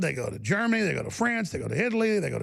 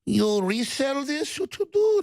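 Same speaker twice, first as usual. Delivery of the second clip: mock suspicious clerk; 8 semitones higher, 3 dB louder than usual. Imitating paranoid shop staff.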